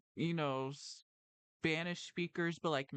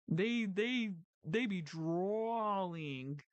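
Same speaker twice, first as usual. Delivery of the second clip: drawn-out, very slow drawl; mock drawl; 2 semitones higher, much slower than usual. Teasing Jersey locals about their accent, in a slow drawl. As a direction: stretch the vowel of drawling way out. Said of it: "it's the drawl".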